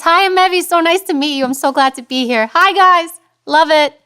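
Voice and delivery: high, loud, over-eager greeting; high gushing voice. Shown as a demonstration. Guest showing how not to introduce herself, high and gushing. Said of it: "Do I talk like this?".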